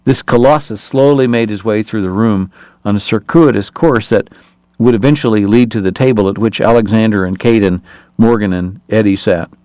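ordinary delivery, nothing marked

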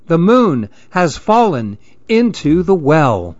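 high, panicky cries for help; frightened shout. Acted. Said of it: "terrified too and shouted".

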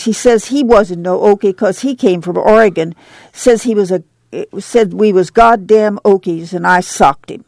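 ordinary delivery, nothing marked

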